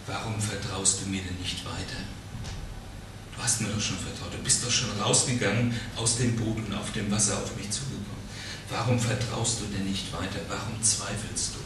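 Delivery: gentle. Acted. Jesus gently asks Peter.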